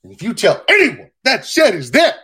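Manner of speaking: abrupt high-pitched screaming threat; sudden threat. Acted. Painter guarding his secret shed, suddenly screaming threat. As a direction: explode from hush into a loud yell. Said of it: "That was a Family Guy joke".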